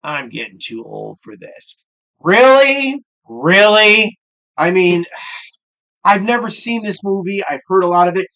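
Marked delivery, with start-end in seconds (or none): 0.0-1.9 mild imitation, lower and much softer; a hint of a worn-out cop; weary old partner quote. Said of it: "And his partner goes". 2.2-4.2 high, loud disbelief; Really? thrown up high and loud in mock outrage; sarcastic double take; incredulous. Real, not acted. Mocking the movie cliché.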